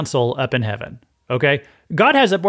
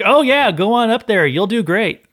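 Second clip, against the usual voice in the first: higher-pitched, sing-song mock encouragement; exaggerated, insincere pep talk, pitched up; really sarcastic; 7 semitones higher, 3 dB louder than usual. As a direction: cheer them on with obvious sarcasm. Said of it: "He's being, like, really sarcastic".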